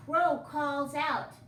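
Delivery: ordinary delivery, nothing marked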